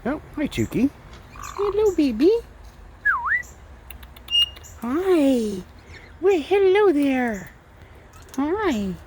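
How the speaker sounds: very high, drawn-out cooing hello; slow high pet-greeting coo. Real, not acted. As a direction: sweet-talk the bird in a high voice.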